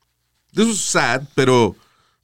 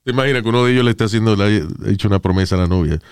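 normal talking voice

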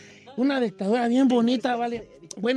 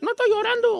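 put-on very high whiny kid voice; childish denial; 3 dB louder than usual. Boy protests to the fairy, very high and whiny. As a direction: squeak it out like a stubborn little boy. Child denying crying, repeated.

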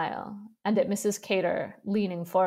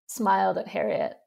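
plain reading voice

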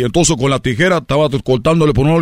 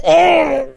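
drawn-out high oh; mock groan after being hit, pitched up; cry of pain; 12 semitones higher than usual. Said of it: "le volvió a pegar al señor". (quejido agudo de dolor)

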